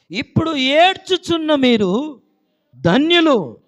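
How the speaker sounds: high, shouted scripture verse; loud proclamation. Real, not acted. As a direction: declare the verse at full force.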